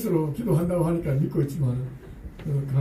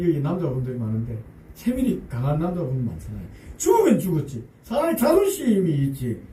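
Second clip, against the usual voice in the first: ordinary delivery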